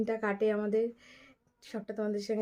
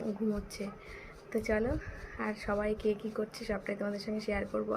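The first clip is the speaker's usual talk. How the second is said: ordinary talk, nothing marked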